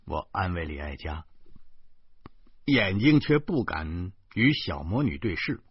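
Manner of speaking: plain reading voice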